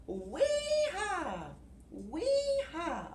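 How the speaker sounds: high gleeful wee-haw; monkey whoop. Acted. Monkey whoops while swinging on his vine, high glee.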